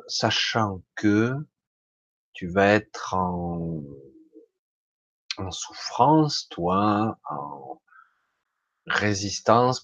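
slow, carefully chosen words; speaking slowly. Real, not acted. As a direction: weigh each word slowly. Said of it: "Je choisis mes mots".

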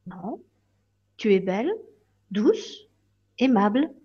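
soft, hushed and tender; gentle fairy. Acted. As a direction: reassure her softly, lifting each word.

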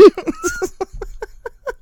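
high wheezing laugh; snorting laughs. Real, not acted. Laughing fit after joke.

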